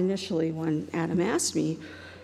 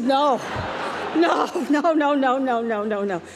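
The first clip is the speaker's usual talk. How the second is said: laughing denial; 5 semitones higher, 4 dB louder, slower than usual. Retold or imitated.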